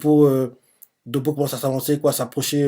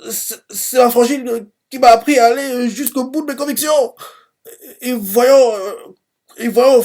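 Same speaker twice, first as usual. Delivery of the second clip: put-on much higher stuttering kid voice, halting; stammering boy; 10 semitones higher, 3 dB louder than usual. Ten standing up to Doppo for his sister, high stammering boyish voice. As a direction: stumble through it like a nervous boy. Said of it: "il bégaye, hein, quand il parle".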